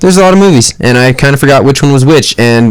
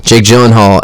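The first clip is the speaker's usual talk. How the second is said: usual speaking voice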